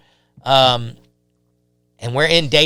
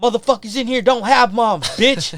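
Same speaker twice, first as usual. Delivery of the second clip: higher-pitched retold jeer; angry inmates; 8 semitones higher, 4 dB louder than usual. Inmates to crying kid, angry mock retort. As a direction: snap back as a mocking inmate.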